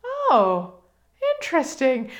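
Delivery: lightly surprised realisation; mild retold surprise. Her reply to Gary, lightly surprised. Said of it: "And I'm like".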